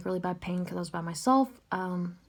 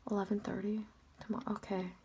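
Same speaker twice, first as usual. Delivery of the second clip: whisper; 8 dB quieter, faster than usual. Retold or imitated. Stunned reply to surgery news.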